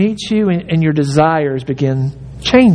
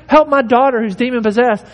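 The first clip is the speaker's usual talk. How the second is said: crying out; 6 semitones higher, faster than usual. Retold or imitated. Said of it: "she was crying out".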